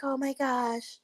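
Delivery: high, fake-sympathetic cooing; imitating a fake friend's comforting; mocking Maya.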